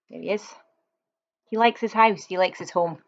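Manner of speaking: ordinary delivery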